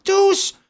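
TOUS flung out very high and loud; emphatic. Acted. Inspector insisting to the chief, emphatic and high. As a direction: slam down the single word.

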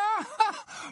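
short, very high jeering laugh; high derisive cackle; mocking laugh. A narrator's reading. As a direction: shriek out a scornful laugh. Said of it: "hurla d'un rire moqueur".

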